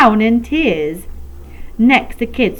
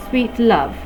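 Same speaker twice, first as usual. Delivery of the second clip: ordinary delivery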